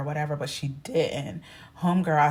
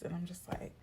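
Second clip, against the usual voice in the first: usual speaking voice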